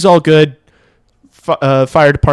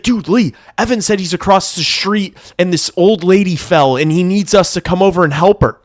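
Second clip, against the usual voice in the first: higher-pitched retelling of the roommate's urgent news; imitating panicked roommate; 6 semitones higher than usual. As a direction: play the roommate trying to sound urgent. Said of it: "he's like, he's like".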